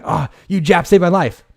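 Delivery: loud, high, gushing thanks; grateful soldier. Retold or imitated. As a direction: blurt out the thanks in a big put-on voice. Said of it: "he's like".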